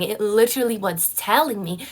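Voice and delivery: usual speaking voice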